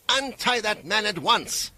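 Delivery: high, commanding woman's voice; sharp command. A narrator's reading. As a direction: order it with authority. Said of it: "sharp with authority".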